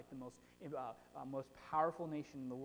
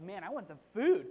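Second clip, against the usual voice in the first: hangry complaint; 9 dB louder than usual. Retold or imitated. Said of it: "you're like, getting all mad".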